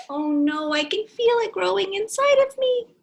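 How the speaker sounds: high, loud, alarmed voice; alarmed cry. Acted. Character feels the watermelon growing, high alarmed cry. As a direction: cry out as the seed grows. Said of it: "He says".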